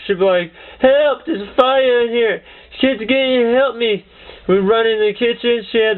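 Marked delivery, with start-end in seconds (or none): 0.8-4.0 higher-pitched mock cry for help; raised-pitch pretend alarm, not a full scream. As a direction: imitate granny faking an emergency. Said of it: "She would scream in there".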